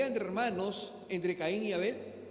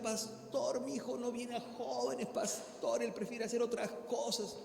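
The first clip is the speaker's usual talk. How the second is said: higher, soft pleading voice of a tearful mother; 4 semitones higher, 4 dB quieter than usual. Retold or imitated. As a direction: plead softly like a weeping mother. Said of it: "Y llorando así en lágrimas decía".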